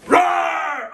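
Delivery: loud, high, hammed-up bear roar; mama bear roar. Mama bear charging someone near her baby, loud mock roar. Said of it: "mama's gonna come up with her big old teeth and go".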